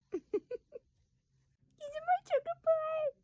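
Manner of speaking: gushing high-pitched pet-name talk. Real, not acted. Breaks off to baby talk.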